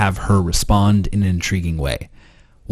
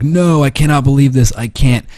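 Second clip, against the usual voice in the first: higher, mildly exaggerated disbelief; mock all-caps reaction; 5 semitones higher, 4 dB louder than usual. Girl reacts to his story, mock all-caps disbelief. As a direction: read her shocked text in mock outrage. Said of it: "her reaction is all caps lock".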